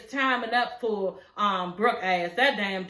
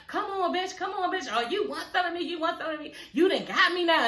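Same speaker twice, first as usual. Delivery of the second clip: mock fight taunt; 6 semitones higher than usual. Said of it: "She getting all mad".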